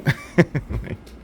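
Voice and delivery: high breathy burst of laughter. Real, not acted. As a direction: laugh mid-rant at the idiot. Laughs at the idiot driver.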